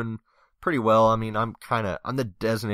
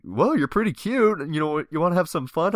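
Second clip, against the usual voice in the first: higher, coaxing come-on voice; flirty guy; 8 semitones higher, 4 dB louder than usual. Retold or imitated. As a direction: playfully coax him to come along.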